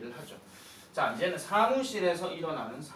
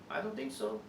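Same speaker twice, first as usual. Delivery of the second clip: 못마땅한 말투; 6 semitones lower, 5 dB quieter, much slower than usual. Acted. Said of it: "지금 쌤 표정 보면 아시겠죠?".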